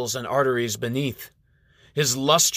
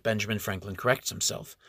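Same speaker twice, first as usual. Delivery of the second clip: usual speaking voice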